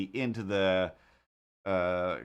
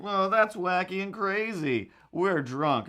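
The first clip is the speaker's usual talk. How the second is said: put-on goofy drunk cop; 7 semitones higher, slower than usual. Drunk cops voiced.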